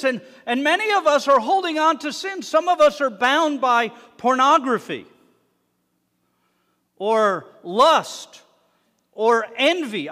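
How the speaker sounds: loud, high preaching build; preaching at full force. Acted. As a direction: hammer the sins out to the room. Preacher listing sins at full force.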